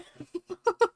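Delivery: high, squealing burst of laughter. Real, not acted. Laughs at own line reading.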